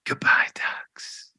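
soft whispered farewell. Pretend. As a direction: breathe it out as a whisper. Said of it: "We're whispering him into".

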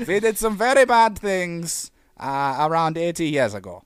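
slow German confession, starting high then dropping; mock German accent. Mock German owning up to history, slow German accent. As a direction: admit it sheepishly in a put-on German accent. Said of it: "Germans always sound like".

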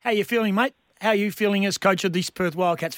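normal talking voice

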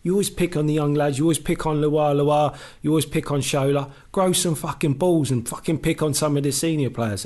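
pitched high, building into a rant; heated retold rant. Player rounds on the coach to stop picking on young lads. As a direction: hammer each "always". Retold row, rising anger.

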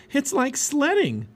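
high, drawn-out delight; excited. A narrator's reading. Girl watching cubs slide down bank, excited. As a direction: stretch the words with glee.

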